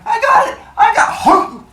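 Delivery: high, loud comic scramble; hammed-up high panicky shout; mock frantic. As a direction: call out as if lunging for a catch. Acted frantic catch in story.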